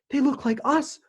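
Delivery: high, eager exclamation; child's bright high exclamation; exclaimed. A narrator's reading. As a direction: blurt it out with delight. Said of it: "Bertie exclaimed".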